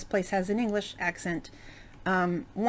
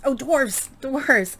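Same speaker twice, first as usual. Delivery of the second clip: excited realization; 6 semitones higher, 6 dB louder, much slower than usual. Real, not acted. Suddenly remembering her topic.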